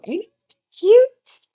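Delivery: high, slow, loud gushing; squealing cute voice. Real, not acted. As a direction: squeal it over something cute. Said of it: "I love that tarot".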